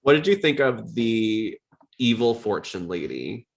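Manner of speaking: ordinary talk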